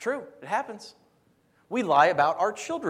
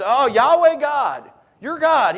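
loud, high, drawn-out invocation; hammed-up pious liar; 7 semitones higher, 11 dB louder, slower than usual. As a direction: call on God with mock grandeur, slow and loud. Acting out Jacob's blasphemous lie.

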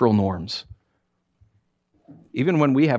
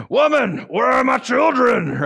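high, loud mock bossy shout; hammed-up demanding husband yelling woman; rude derogatory demand; 10 semitones higher, 3 dB louder, slower than usual. Shown as a demonstration. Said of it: "it's really derogatory".

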